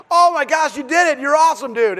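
high, loud gushing; elated praise. Retold or imitated. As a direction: gush like an overjoyed dad. Beaming dad retells his praise.

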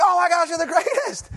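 high, loud gushing praise; exploding with joy. Retold or imitated. Dad gushing to his kid after a play, exploding with joy. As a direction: squeal it like an overjoyed fan. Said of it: "I nearly exploded with joy".

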